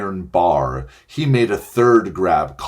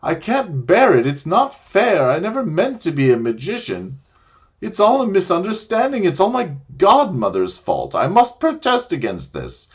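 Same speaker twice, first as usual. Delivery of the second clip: wailing; 7 semitones higher than usual. Acted. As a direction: wail and complain in self-pity. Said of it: "it was wailing on".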